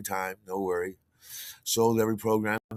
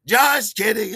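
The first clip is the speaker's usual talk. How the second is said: playful reveal; 10 semitones higher, 10 dB louder, slower than usual. Retold or imitated. Joke after the fake price hike.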